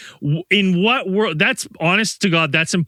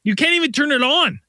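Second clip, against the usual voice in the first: retold outburst; 13 semitones higher than usual. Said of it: "I was like".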